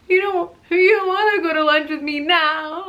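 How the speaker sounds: high, loud mock-tearful wail; hammed-up retold whimper; drama queen wail. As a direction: whine it like a self-pitying drama queen. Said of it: "I'm such a drama queen".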